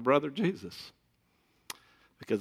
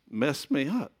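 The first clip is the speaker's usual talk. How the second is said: normal talking voice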